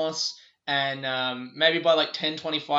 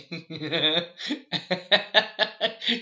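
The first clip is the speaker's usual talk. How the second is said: a long, gleeful burst of laughter at their bad luck; real laughter. Real, not acted. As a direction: laugh freely, you know what's coming.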